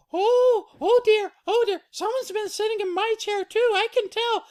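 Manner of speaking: very high, loud falsetto; Mama Bear fretting. Acted. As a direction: flutter high as the upset mother bear. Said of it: "she goes".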